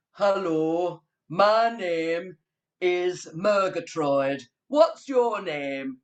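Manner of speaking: slow, odd put-on puppet voice. Shown as a demonstration. Teacher shows how Murgatroyd the puppet greets children, slow put-on voice. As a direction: drag out the puppet's introduction.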